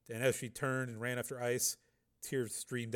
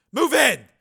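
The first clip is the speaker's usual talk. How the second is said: high, loud military order; shouted command; 13 semitones higher, 15 dB louder than usual. Acted. Commander orders units in by helmet mic, shouting. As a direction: shout it to the troops. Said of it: "Volkirk shouted into his helmet mic".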